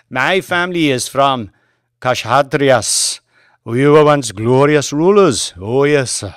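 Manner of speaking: put-on a driver proudly telling Banks of glorious ancestors, Indian accent. Driver boasts of his family.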